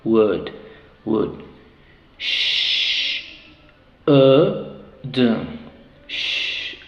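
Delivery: ordinary talk, nothing marked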